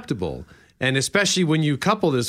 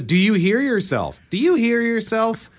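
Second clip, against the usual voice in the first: drawn-out mock indignation, pitch climbing with each repeat; escalating confrontation; 10 semitones higher than usual. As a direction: build to a high, slow, accusing challenge. Repeating argument-starting phrase, escalating.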